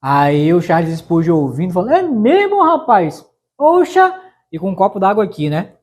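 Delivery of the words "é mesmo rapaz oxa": high, playful é mesmo rapaz; teasing surprise, lightly voiced; mock surprise. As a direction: feign amazement, pitch up.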